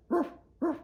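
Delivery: high yappy dog barking; playful puppy ruff ruff. Acted. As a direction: bark it out like a little dog. Dog bark imitated in reading.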